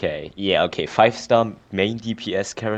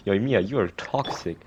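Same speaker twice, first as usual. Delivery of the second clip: ordinary talk, nothing marked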